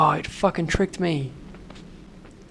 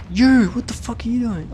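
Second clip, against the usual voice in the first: startled outburst; 6 semitones higher, 5 dB louder than usual. Real, not acted. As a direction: gasp then a loud, high cry. Startled by the spider.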